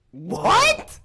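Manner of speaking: a sudden high, loud disbelieving "What?"; shocked. Real, not acted. Podcaster reacting to the coach's insult, high and loud in shock. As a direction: burst out in stunned disbelief.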